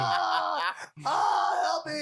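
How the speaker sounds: high, loud gasping plea; mock panic cry. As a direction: cry out as if overdosing. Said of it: "I was like".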